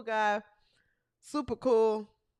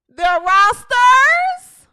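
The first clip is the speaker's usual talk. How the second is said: very loud, stretched, higher announcer delivery; announcer shout; 8 semitones higher, 15 dB louder, much slower than usual. Real, not acted. Host revealing league rosters to viewers, big announcer shout. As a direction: belt it out slowly like a big reveal.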